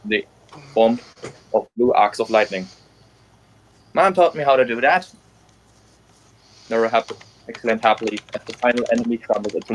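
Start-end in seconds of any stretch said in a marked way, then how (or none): 3.9-5.1 put-on lightly raised, happy young heroine; proud little grin in the voice; cheerful heroine voice. Nero's happy quote.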